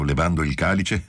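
ordinary delivery

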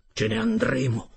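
high-pitched, sudden declaration; exclamation. A narrator's reading. Narrator telling his companions they will flee, exclaiming.

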